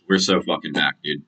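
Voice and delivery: ordinary talk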